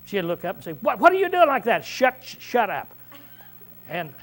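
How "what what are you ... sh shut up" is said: higher, indignant snap at first; mock angry outburst. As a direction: act out someone getting mad. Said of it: "she'd get mad".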